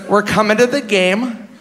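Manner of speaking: hint of a sweet older Green Bay fan's accent. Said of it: "You know that stupid fuck accent?".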